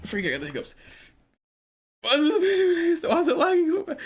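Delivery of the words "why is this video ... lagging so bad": high laughing squeal through the words; cracking up in a high voice while talking. Real, not acted. Laughing at the handstand clip.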